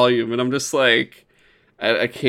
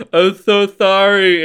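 loud, slow, over-the-top sorry; hammed-up apology; 6 semitones higher, 7 dB louder, slower than usual. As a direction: drag it out like a melodramatic anime apology. Character apologises in dramatic scene.

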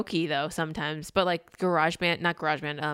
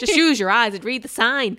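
higher, louder exasperation; impatient, playfully fed up; mock scolding; 5 semitones higher, 7 dB louder than usual. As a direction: scold her for missing the obvious. Acted scolding of film character.

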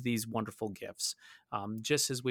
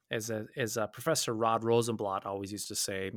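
usual speaking voice

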